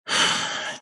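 soft breathy exhale, imitating relief; quiet acted sigh. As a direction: let the pressure out in a sigh. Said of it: "Takes a sigh of relief".

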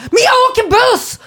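high, flustered cry, half laughing; mock panicked outburst. Panicked retort to stranger on bus.

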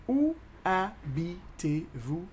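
very slow, separated syllables; drawn-out teaching demo. Shown as a demonstration. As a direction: split "Où habitez-vous" into single beats. Teacher slows French question.

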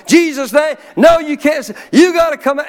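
high, very loud commanding yell; desperate shout. Retold or imitated. Pastor straining to cast the demon out, shouting. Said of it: "I'm doing everything I know".

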